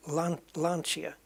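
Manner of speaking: Lancia slowly restarted, LAN-chee-a; ch sound. Shown as a demonstration. Gamer struggling to correct it, Lancia slowly with ch. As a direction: try Lan-, then Lancia with a ch. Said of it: "is like a ch".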